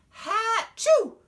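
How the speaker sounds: a big, squealed sneeze; loud high sneeze. Acted. Jenny sneezing at her drawings, loud high sneeze. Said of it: "she sneezed".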